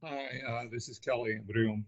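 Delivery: faint and far from the mic; very soft, hard to hear; barely audible. Real, not acted. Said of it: "Can you speak up".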